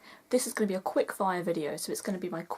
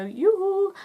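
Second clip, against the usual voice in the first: much higher, louder sing-song answer; family's reply; 12 semitones higher, 8 dB louder than usual. Retold or imitated. Oaken's family answering from the sauna, high loud call. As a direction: sing the yoo-hoo back cheerily. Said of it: "and the family go".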